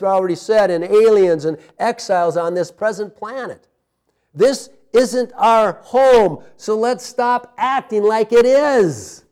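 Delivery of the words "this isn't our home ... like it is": louder, slow, every word weighed; a slow, pleading push to the congregation; slow emphatic appeal. Acted. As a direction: press it home slowly and firmly. Preacher urging the congregation.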